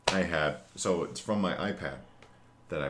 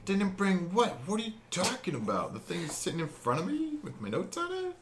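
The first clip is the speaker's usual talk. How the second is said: high incredulous outrage; baffled, pitched way up; indignant disbelief. Real, not acted.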